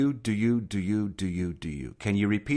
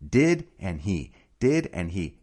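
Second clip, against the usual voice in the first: slow word by word; slower than usual. Shown as a demonstration. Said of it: "start recognizing this combination".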